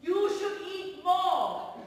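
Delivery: loud, high, drawn-out order; motherly command. Acted. Elderly patient tells the thin young doctor off, loud and motherly. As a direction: tell them off slowly at full voice.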